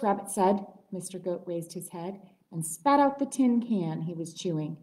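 ordinary delivery

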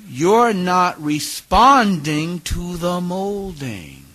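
retold mentor stretching each word for emphasis; pointed slow rebuke. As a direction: spell out the fault word by word.

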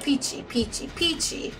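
urgent whispered "Peachy". Retold or imitated. Imagined fan urging Peachy to win, whispered. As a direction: hiss the name "Peachy" as a whispered cheer. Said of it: "It's like".

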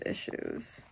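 issues whispered, trailing into breath. Real, not acted. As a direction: let "issues." fade to a whisper. Repeating issues, fading away.

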